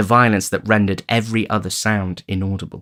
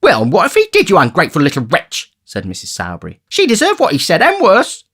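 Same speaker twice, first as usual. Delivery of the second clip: high, shrewish woman; high, spiteful wife berating the boy; Mrs Sowerby; 10 semitones higher, 6 dB louder than usual. A narrator's reading.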